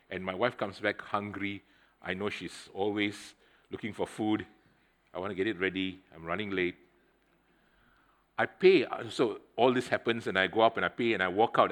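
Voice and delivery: ordinary talk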